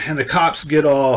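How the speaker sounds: plain reading voice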